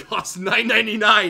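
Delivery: cracking up mid-sentence; reading the joke while laughing loudly; laughing through the line. Real, not acted. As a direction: laughs out the punchline.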